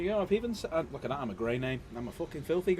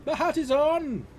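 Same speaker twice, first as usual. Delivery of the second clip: excited; 12 semitones higher, 5 dB louder, slower than usual. Real, not acted. Proud hat reveal on stream.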